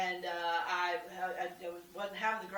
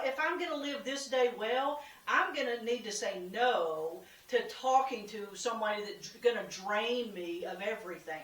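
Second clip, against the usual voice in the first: ordinary delivery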